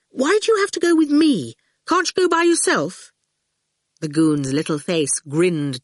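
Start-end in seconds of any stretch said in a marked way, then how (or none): 0.1-3.1 put-on higher boyish voice, resentful; a put-upon boy complaining, pitched high. A narrator's reading. Howard resenting being followed by the goon. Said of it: "Howard said resentfully".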